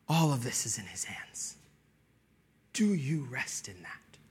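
hushed whisper of reassurance. Acted. Sudden whisper after build.

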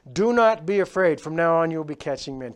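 commanding reassurance. Acted. Jesus reassures kneeling fishermen.

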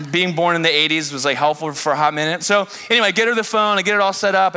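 ordinary delivery

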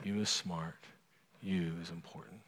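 put-on calm a bawling child gently; nanny comforting the crying girl on her lap, very soft; hushed comfort. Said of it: "she's like".

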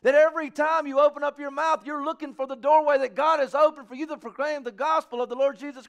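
high, loud, fervent preaching; preaching at full force. Acted. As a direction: drive the call home to the congregation. Preaches a charge to the church.

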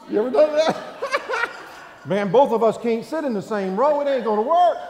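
laughing loudly through the words; loud laughing punchline. Real, not acted. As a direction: laugh it out to the crowd. Laughing with crowd.

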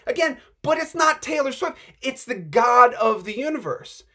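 ordinary delivery

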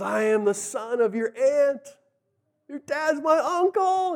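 high, drawn-out mock wail; mock weeping. Jacob meeting his cousin Rachel, mock weeping. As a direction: wail it high and slow, hamming up the tears. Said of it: "and he's weeping".

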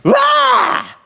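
sudden very high-pitched scream; hammed-up shriek of labour pain; mock scream of a woman giving birth; imitated childbirth scream. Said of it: "a woman without an epidural has a baby".

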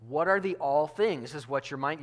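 ordinary delivery

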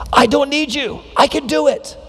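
put-on high, dismissive teen voice; a teenager pushing his parents away; defiant teenager. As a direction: toss it off like a sulky teenager. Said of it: "you hear them say".

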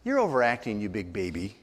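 low, scornful put-down; contemptuous attitude. Shown as a demonstration. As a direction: drop the voice and belittle them. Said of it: "saying with your voice and your attitude".

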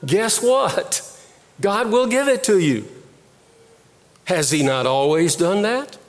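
usual speaking voice

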